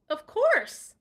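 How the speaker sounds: eager Terrence, quick and keen; quick eager reply. Acted. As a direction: jump in eagerly. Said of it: "Terrence said quickly".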